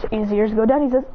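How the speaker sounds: ordinary delivery, nothing marked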